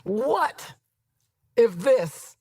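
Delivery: slow, spaced-out dramatic question; retold dramatic reveal. Friend posing a bold what-if to Lisa, dramatic. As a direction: land it word by word with long pauses. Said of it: "She goes, Lisa".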